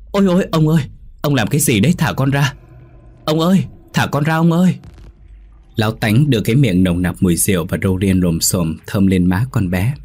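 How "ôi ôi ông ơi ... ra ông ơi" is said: terrified cry. A narrator's reading. Young servant girl begs her drunk master, frightened high cry. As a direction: scream for help, pulling away. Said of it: "miệng hét lên".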